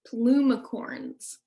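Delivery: plumicorns said very slowly; slow word teaching. Shown as a demonstration. Teacher introducing a new word to children, plumicorns drawn out slowly. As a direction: draw out plumicorns for learners to copy. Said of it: "say that word with me".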